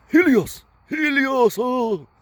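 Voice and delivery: put-on very high, loud hammy cry in an English accent; mock English film accent. Mocking a Greek character calling Helios, high English-accented cry. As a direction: wail it like an overacting English movie actor. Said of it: "That is not the way people from Greece talk".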